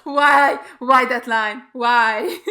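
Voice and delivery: loud, pained plea through laughter; exasperated. Real, not acted. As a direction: wail it in amused disbelief at the writing. Exasperated laughing reaction to a line.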